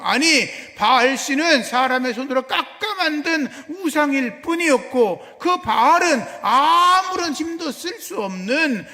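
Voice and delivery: loud, high-pitched pulpit proclamation; preaching at full force. Real, not acted. Preacher declaring to the congregation that Baal is powerless, forceful preaching. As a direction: drive every phrase home at full volume.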